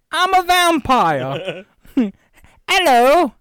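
high, loud hammed-up British "Hello, governor"; mock British accent. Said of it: "sounding like that".

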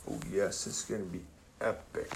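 hushed. Acted. Hushed teaser before the reveal.

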